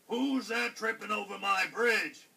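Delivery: loud, booming troll challenge; troll's loud demand; roaring troll. A narrator's reading. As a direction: roar it out as the troll guarding the bridge. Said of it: "roared the troll".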